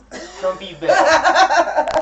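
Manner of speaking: high, loud and slow, laughing through it; laughing ad pitch. Pretend. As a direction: stretch it out while cracking up.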